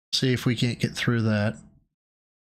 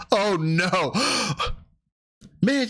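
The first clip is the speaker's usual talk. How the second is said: ordinary delivery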